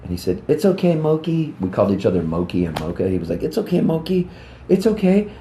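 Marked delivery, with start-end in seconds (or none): 0.5-1.5 comforting friend. Retold or imitated. Friend comforting crying boy. 3.4-5.3 higher, soothing best-friend voice, imitated; lightly voiced boyhood friend consoling; comforting friend. Friend comforting crying boy.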